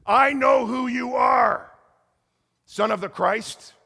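high, loud, slow, eerie shouted accusation; possessed woman's shout. Retold or imitated. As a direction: loom over him and shout it slowly. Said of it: "you gotta put that with a Dutch accent".

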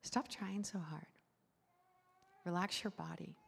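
soft, calm reassurance; quiet phrases with space between; gentle invitation. Real, not acted. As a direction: softly invite them to let go.

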